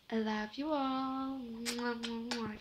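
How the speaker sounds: slow, sweet goodbye with blown kisses; affectionate sign-off. Real, not acted. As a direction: send love to the viewers. Vlog sign-off to viewers.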